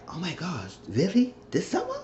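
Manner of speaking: high-pitched gossipy disbelief; mock shock. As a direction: imitate a scandalized onlooker, pitch climbing. Imitating shocked gossip reaction.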